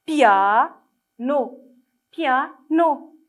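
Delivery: "Piano" loudly broken into pia, pause, no; syllable-by-syllable reading. Shown as a demonstration. Teacher reading the next word for children, "Piano" split by syllables. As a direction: sound out "Piano" with a gap before the last syllable. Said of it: "on essaye de lire le mot suivant".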